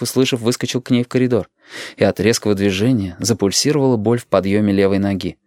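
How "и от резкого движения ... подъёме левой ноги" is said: usual speaking voice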